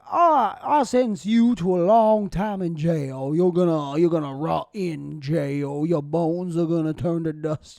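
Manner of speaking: put-on loud, slow Southern judge accent sentencing a man. Comic on stage doing his bit as a Southern judge accent. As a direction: boom it out like an old Southern judge. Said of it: "Southern judges talk like this".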